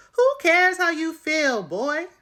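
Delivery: high, loud, drawn-out disbelief; exasperated high-pitched holler; indignant outburst. Pretend. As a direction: yell it back at him, stretching the words. Outburst at Uche's retold line.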